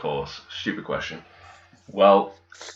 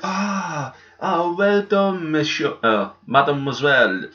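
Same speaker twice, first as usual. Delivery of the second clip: imitated admiring friends; 7 semitones higher than usual. French teacher's friends congratulating her on a job in England, mock gushing.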